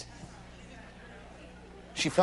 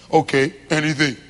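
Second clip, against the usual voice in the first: much lower, blunt literal reply; deadpan husband; 6 semitones lower, 7 dB louder than usual. Acted. Husband answering his wife, a low deadpan dodge. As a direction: dodge her with a shrug in the voice.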